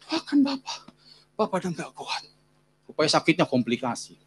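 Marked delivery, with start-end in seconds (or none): 0.1-2.2 high, faint voice of a sick father; acted feeble plea for prayer; weak, pleading. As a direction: plead softly as strength runs out.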